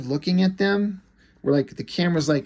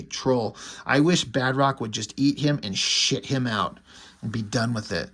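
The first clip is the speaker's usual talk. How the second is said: usual speaking voice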